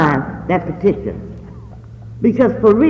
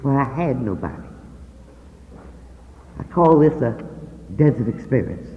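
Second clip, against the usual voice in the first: hushed sorrow; 7 semitones lower, 8 dB quieter than usual. Real, not acted. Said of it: "feeling very low".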